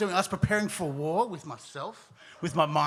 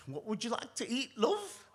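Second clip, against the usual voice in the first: higher, softer voice lifting sharply on "love"; doting parents; 7 semitones higher, 3 dB quieter than usual. Retold or imitated. Parents asking their child what to eat, in a high, soft parent voice. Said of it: "my parents are like".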